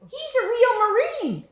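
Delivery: put-on British boy's voice. Little British boy asking the narrator about the game, high British accent. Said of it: "He had an accent. It sounded British".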